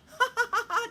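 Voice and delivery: high, loud mock cackle; loud acted ha-ha-ha, high-pitched; mocking prankster laugh. Boy laughing at mom after tricking her, gleeful cackle.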